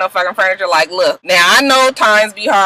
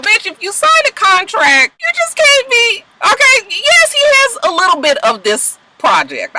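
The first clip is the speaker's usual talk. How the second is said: high and raised, near shouting; high-pitched exasperated lecture; exasperated scolding; 10 semitones higher than usual. Real, not acted. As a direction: scold her in a high, frustrated voice. Scolding Mimi directly.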